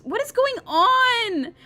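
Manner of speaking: high, loud, drawn-out cry of confusion; baffled outburst. Real, not acted. Player too lost to follow the plot, baffled outburst. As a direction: burst out loudly, completely lost.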